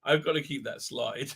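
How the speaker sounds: normal talking voice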